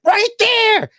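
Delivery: high, loud excited yell; excited shout. Retold or imitated. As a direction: shout it like spotting a star. Friend excitedly points out celebrity.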